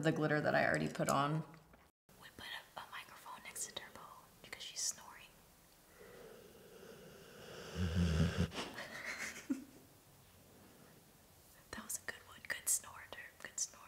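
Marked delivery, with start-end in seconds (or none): 2.2-5.3 soft whisper so the dog sleeps; hushed whisper near a sleeping dog. Real, not acted. Dog snoring next to the mic. 7.7-9.6 stifled laugh. Real, not acted. Dog snoring next to the mic. 11.7-13.8 hushed whisper, amused. Real, not acted. Dog snoring next to the mic.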